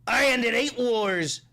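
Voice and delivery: high, loud, slow mock bragging; mock boast. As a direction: drag out a smug brag. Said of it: "the question I have is".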